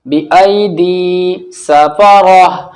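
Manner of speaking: very slow "बिअयदी सफरह" with long vowels; flowing recitation. Shown as a demonstration. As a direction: recite it, stretching each long syllable. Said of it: "रवानगी से पढ़ते हैं". ("बिअयदी सफरह" खींचकर तिलावत)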